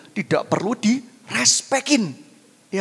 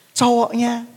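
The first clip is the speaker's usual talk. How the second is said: ordinary delivery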